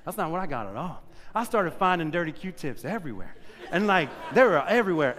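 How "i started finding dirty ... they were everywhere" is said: laughing while telling it; amused, half laughing, at the prank. Real, not acted. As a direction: let the laugh run through the words. Laughs through the Q-tip story.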